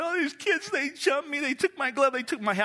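put-on high, upset child's voice; a little boy telling mom through tears; crying kid. Boy tells his mom the kids jumped him, upset and high. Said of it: "I said".

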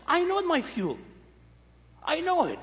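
keyed-up, high, proud reply; excited declaration. Retold or imitated. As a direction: proclaim it like a man sure of his fuel. His excited answer to daughter.

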